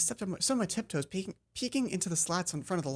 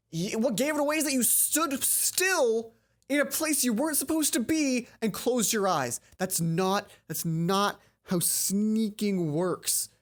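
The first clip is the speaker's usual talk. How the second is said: higher, louder, slow, mocking scold; scolding character voice; 4 semitones higher, 3 dB louder, slower than usual. Character scolding Hana for sneaking badly, higher mocking voice. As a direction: lecture her like a know-it-all.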